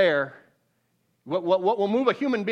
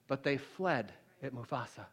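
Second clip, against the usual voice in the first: ordinary talk, nothing marked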